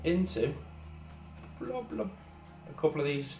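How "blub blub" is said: high soft gloop noise; blub blub pouring noise. Real, not acted. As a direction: make a tiny pouring sound. Pouring sound effect.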